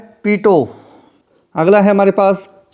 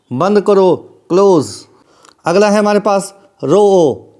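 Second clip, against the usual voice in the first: ordinary delivery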